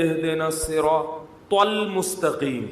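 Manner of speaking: slow recitation with the ط of الصراط hit hard, mocking; the ط in الصراط punched heavily, slowly; imitating an emphatic ta. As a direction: overdo the ط in الصراط. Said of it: "جہاں تو آئے گی نا".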